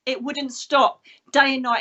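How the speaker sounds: ordinary delivery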